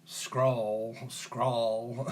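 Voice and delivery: long, stretched Southern US vowel; mock Southern drawl. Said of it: "is that the southern".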